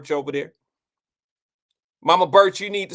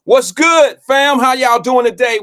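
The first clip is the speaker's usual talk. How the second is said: high, loud hype greeting; 7 semitones higher, 11 dB louder, slower than usual. Real, not acted. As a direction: call out to the crowd. Host greets the live audience.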